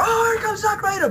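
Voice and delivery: high excited announcer shout; mock excited intro. Mimicking how commentary should greet Zack Ryder, high excited shout. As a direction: announce him like a hero. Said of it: "he's like".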